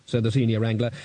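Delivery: plain reading voice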